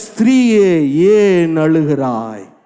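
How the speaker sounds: low, very slow falling delivery; weighty, drawn-out quoted voice from scripture; solemn divine question. Retold or imitated. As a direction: stretch the question out gravely. Voices the question to weeping woman.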